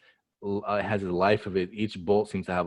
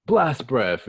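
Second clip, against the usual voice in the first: loud, raised mock battle cry; attack call; 5 semitones higher, 6 dB louder than usual. Recapper as Kaido firing his attack, loud. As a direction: call out the move with force.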